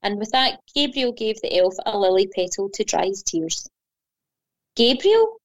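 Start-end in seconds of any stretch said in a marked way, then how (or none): 4.7-5.4 high, louder questioning rise; astonished gasp. A narrator's reading. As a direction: gasp the name in awed surprise. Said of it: "gasped the elf".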